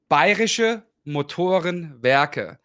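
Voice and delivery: Bayerische Motorenwerke slowly in German; German pronunciation. Shown as a demonstration. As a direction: pronounce Bayerische Motorenwerke carefully, half speed. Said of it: "it is the shortcut for".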